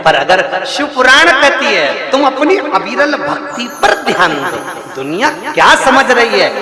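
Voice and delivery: driving sermon in rising, repeated phrases; impassioned preaching. Real, not acted. Preacher urging devotees to keep up Shiv bhakti, impassioned exhortation. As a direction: exhort the crowd, lifting pitch on each new command.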